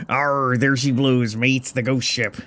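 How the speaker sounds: put-on gruff old sea dog pirate; pirate accent. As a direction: growl it like an old salty pirate. Pirate captain's lines.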